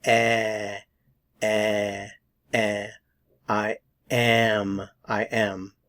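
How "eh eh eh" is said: eh held out alone as a sound; lone vowel eh said slowly; isolated vowel eh. Shown as a demonstration. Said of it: "The sound that you're pronouncing here is".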